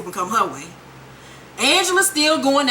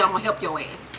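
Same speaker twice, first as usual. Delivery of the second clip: sly low promise; 5 semitones lower, 6 dB quieter, faster than usual. Retold or imitated. Imitates Greg's shady promise.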